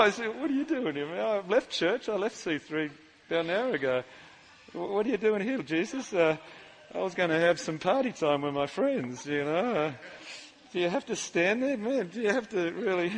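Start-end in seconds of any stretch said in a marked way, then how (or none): 0.4-6.1 quiet, taken-aback question; hushed, caught out by Jesus at the door; startled self to Jesus. Acted. Preacher acting caught out by Jesus in his house, hushed and startled. 6.9-13.1 quiet, sheepish whine; sheepish complaint. Acted. Preacher acting annoyed that Jesus stands in his house, quiet sheepish whine.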